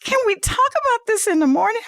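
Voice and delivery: imitating husband with mask off, high and quick; comic put-on plea to postpone; husband just woken. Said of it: "he pulls the thing off".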